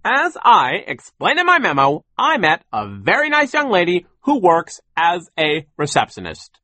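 loud, high, emphatic lecturing; blustering boss explaining himself; pompous boss. A narrator's reading. Sir lectures children.

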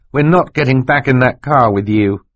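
firmly voiced child character. A narrator's reading. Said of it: "Klaus said firmly".

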